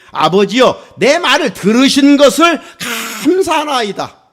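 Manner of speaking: loud, high solemn prayer; Jesus praying. Retold or imitated. Jesus thanking the Father before the tomb, solemn loud prayer. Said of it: "이렇게 기도하셨어요".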